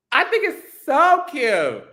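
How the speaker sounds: high, slow, delighted gush; stretched high-pitched cooing; drawn-out gushing. Real, not acted. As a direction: gush it slowly, leaning into SO. Gushing over the voice, repeated.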